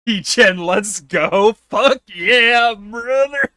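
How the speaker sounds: loud, high, excited shout; hyped cheer. Real, not acted. Streamer cheering someone on, loud hyped shout. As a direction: cheer them on at full volume.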